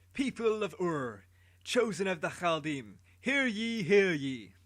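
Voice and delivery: loud, slow call to the crowd, rising on hear ye; herald's proclamation. A narrator's reading. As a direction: announce like a royal herald. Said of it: "Anuki began".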